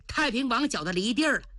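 usual speaking voice

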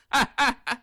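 a short scornful laugh at the threat; mocking laugh. The bully Niu Er laughs off Yang Zhi's threat, scornful laugh. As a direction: laugh him off, sneering.

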